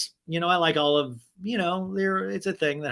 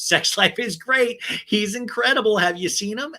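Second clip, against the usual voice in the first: higher, louder mock gush; 7 semitones higher, 4 dB louder than usual. Cast member bragging to producer about boyfriend, gushing. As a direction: imitate a thrilled girlfriend bragging. Retold gushing talking-head answer.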